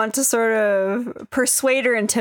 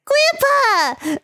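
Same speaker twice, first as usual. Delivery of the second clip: put-on very high, delighted child's cry; excited little girl; 4 dB louder than usual. Little Sarah leaping to her grandfather, high excited squeal. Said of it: "she kinda looks down from your shoulder and is like".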